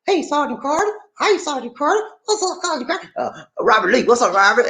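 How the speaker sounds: high Gomer Pyle imitation. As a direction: call each name like Gomer. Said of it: "can't get rid of that Gomer".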